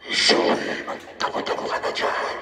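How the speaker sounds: deep distorted demon voice; demonic layered voice. Shown as a demonstration. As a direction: growl it like a demon through the mic. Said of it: "boses demonyo".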